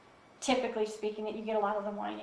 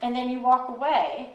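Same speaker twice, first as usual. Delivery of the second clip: usual speaking voice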